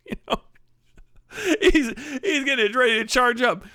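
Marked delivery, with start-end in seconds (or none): none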